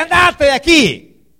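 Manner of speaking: loud, slow, high command; commanding shout. Retold or imitated. Jesus casting out a demon, loud slow command. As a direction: order the demon out with force. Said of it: "Jesús no le gritaba".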